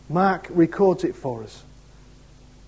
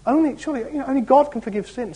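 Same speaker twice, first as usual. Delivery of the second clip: lightly raised mock scribe voice; quoting the scribes; 9 semitones higher, faster than usual. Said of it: "They're saying".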